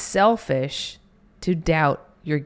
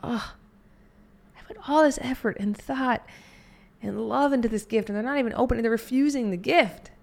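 higher, mock wounded lament; hurt letdown; 7 semitones higher, faster than usual. Giver hurt by the refused gift, higher mock lament. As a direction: play the hurt of a rejected gift. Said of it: "this like".

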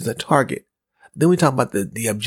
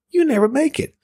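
teasing salesperson; 13 semitones higher than usual. Retold or imitated. Quoting salesperson's teasing verdict.

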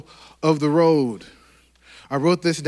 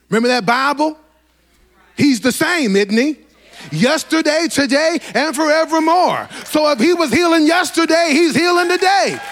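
higher and louder, rising preacher's cadence; full-force preaching; 10 semitones higher, 5 dB louder than usual. Acted. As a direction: preach it with mounting fire to the church.

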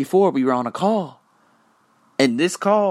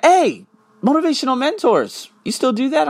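much higher, lightly voiced friend asking; imitating friend; 13 semitones higher, 3 dB louder than usual. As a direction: pitch it up as the curious friend. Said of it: "she's like".

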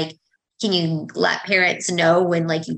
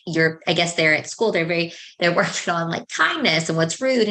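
ordinary talk, nothing marked

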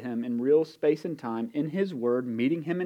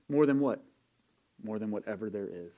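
ordinary talk, nothing marked

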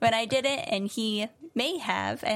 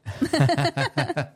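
loud laugh; 8 semitones lower, 7 dB louder than usual. Real, not acted. Laughing at spelling.

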